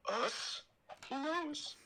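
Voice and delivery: high breathy falsetto Padme, swooning; hammed-up high Padme voice. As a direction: squeal her name in a high falsetto. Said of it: "Miss Piggy?".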